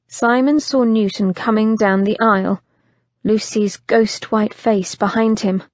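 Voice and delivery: plain reading voice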